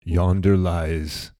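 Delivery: slow, grand Shakespearean delivery; hammy stage actor. Pretend. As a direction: overact like an old stage actor. Mock Shakespeare after goofy Jesus talk.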